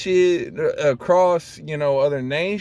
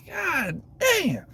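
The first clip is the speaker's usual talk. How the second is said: amazed; 13 semitones higher, 4 dB quieter than usual. Retold or imitated. Retold amazement, high.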